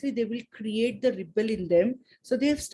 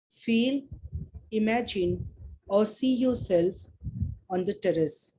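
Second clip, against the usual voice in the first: calm guiding voice, easing them in; guided meditation. Acted. Leads a meditation.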